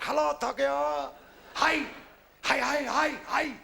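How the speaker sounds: hammed-up Japanese man answering his tooth; mock Japanese phone call. Japanese man answering a phone in his tooth, put-on high voice.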